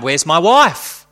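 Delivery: loud slow cry; cried out. Acted. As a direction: call out desperately into the dark. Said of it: "Again he cried".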